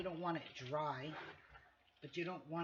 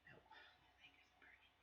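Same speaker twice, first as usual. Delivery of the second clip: whisper; 22 dB quieter, faster than usual. Real, not acted. Sudden pain mid-cooking.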